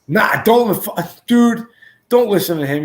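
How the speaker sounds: high, loud frantic yell; Rory yelling. Retold or imitated. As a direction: shout him down in a panic. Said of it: "And then Rory's like".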